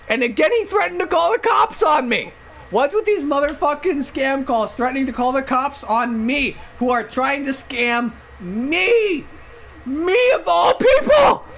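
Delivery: high, indignant rant building to a shout; mock outrage. Acted outrage at scam callers.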